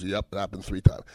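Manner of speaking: low, quiet retold confession; resigned admission. Flattened player admitting it to his teammate, quietly resigned. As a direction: drop it down low and soft, resigned.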